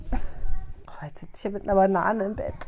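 fast whispered aside; whispering. Real, not acted. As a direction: whisper it quickly to yourself. Aside while child runs off.

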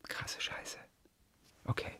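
awed whisper. Real, not acted. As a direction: breathe it out in awe. Awed after finishing the poem.

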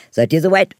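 loud, high rallying cheer; rally the party; rallying call. Acted. Prince rallies the guests. (lauter Aufruf)

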